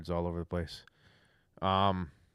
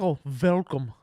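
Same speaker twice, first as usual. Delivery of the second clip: put-on Norwegian accent; 5 semitones higher, 6 dB louder than usual. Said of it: "imagine why there was an accent".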